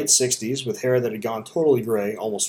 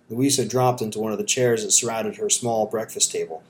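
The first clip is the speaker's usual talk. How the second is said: ordinary delivery, nothing marked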